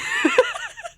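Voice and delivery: high-pitched burst of laughter; shrieking laugh. Real, not acted. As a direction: cackle helplessly. Breaks up laughing.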